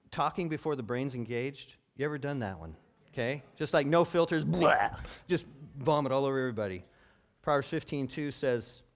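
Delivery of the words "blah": loud, blurted "blah"; mock vomiting noise. Preacher imitating words vomited over everybody, mock retching. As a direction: spew it out with no filter.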